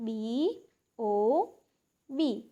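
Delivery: બી, ઓ, બી as separate letter names, paused between; letter-by-letter spelling. Shown as a demonstration. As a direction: spell out બી... ઓ... બી for pupils. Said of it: "તો પહેલું".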